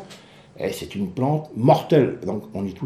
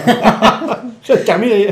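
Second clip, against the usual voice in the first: laughing joke; 7 semitones higher, 6 dB louder, slower than usual. Real, not acted. Joke about deadly flower.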